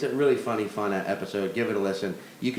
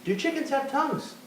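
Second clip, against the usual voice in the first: silly high voice; 13 semitones higher, 4 dB quieter than usual. Acted.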